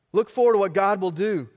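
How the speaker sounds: forceful repeat of the call. Real, not acted. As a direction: Look forward said loud and emphatic. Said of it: "repeated with force".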